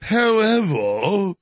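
slow, stretched mock royal British accent; lightly imitating a posh regal accent. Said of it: "he loves to have the most fake royal, uh, regal".

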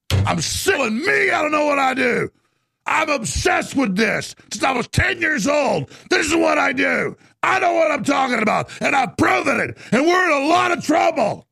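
high, strained shouting rant; real angry rant. Real, not acted. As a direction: shout it out in exasperation. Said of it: "See, I'm getting mad".

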